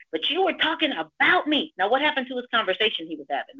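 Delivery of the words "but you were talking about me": retold yelling. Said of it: "He's yelling at me".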